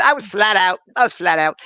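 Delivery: imitated Cockney exclamation; Mickey. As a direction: quote it as a Cockney newsboy. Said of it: "Mickey was a Cockney".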